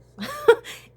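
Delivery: a loud, high burst of laughter. Real, not acted. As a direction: laugh hard at your own idea. Laughing at own theory.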